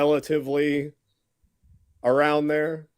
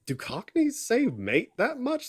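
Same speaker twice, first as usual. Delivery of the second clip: incredulous; 9 semitones higher, slower than usual. Real, not acted. Incredulous at fake accent.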